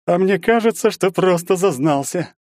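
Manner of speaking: high-pitched needling voice of a stranger; snake-like taunt. A narrator's reading. Stranger accusing the hero of snobbery, snakily taunting. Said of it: "по-змеиному прошипел незнакомец".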